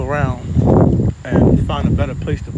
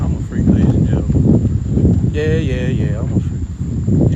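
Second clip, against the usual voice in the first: mock announcement; 7 dB louder, slower than usual. Real, not acted. Announces himself to the crowd.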